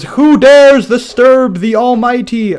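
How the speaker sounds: slow, loud, high commanding queen; booming queen voice. Acted. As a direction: boom it out like a self-important ruler. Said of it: "Battle Winner goes".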